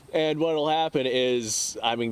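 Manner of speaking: normal talking voice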